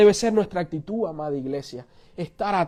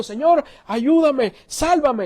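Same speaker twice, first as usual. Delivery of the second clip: higher, drawn-out plea for rescue; mock cry to heaven for help; desperate prayer; 6 semitones higher, much slower than usual. Drowning man prays to be saved, pleading. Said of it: "y está orando".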